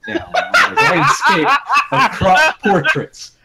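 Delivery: can't keep a straight face; laughing while reading. Real, not acted.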